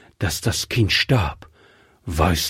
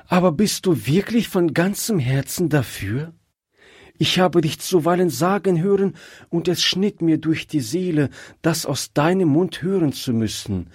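lightly voiced wife, higher and eager; joyful but probing wife; happy wife; 7 semitones higher than usual. Wife asks husband if he truly wants the child, eager. Wife delighted yet doubting.

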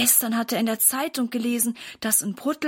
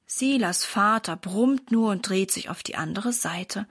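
ordinary delivery, nothing marked

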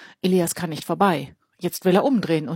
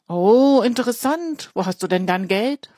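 mocking; 5 semitones higher, 6 dB louder than usual. Fat boy mocks Elias.

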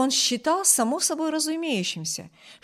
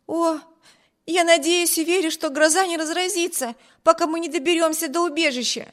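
higher, pleading, hurried hope; anxious exclaim; 7 semitones higher, 3 dB louder than usual. A narrator's reading. Amy fearing the storm on the road, anxious exclaim. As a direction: rush the words out in fear of thunder. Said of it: "воскликнула Эми".

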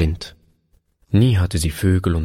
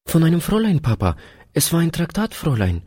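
put-on very high little-girl answer; little daughter; 11 semitones higher, faster than usual. A narrator's reading. Daughter telling Papa who taught her, high child's voice.

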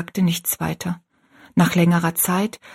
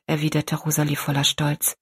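ordinary delivery, nothing marked

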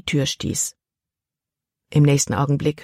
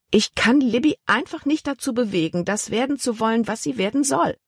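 höhere, vornehme ältere Tante; 7 semitones higher than usual. A narrator's reading. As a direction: eine besorgte Dame der Gesellschaft, hoch gesprochen. Tante Vi spricht. (Tante Vi klagt beim Kapitän, mit höherer Stimme)

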